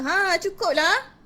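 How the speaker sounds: usual speaking voice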